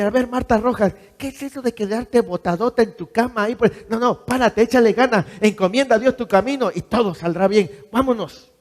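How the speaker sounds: a hint of a stern self-lecture; scolding yourself. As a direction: tell yourself off to get up. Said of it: "usted se tiene que decir".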